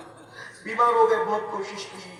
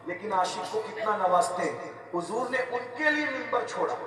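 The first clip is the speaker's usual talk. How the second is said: usual speaking voice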